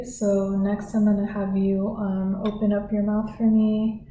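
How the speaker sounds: ordinary talk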